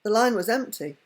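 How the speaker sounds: usual speaking voice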